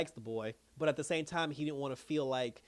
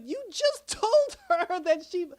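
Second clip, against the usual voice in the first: high, slow, loud incredulity broken by laughter; laughing disbelief; 13 semitones higher, 7 dB louder, slower than usual. Retold or imitated. Viewer reacting to Penguin's betrayal, laughing disbelief. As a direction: crack up mid-protest, voice pitched way up.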